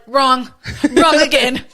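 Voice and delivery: loud, high teasing call; correcting a friend's mistake, loud teasing; mock buzzer. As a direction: announce it like a quiz host.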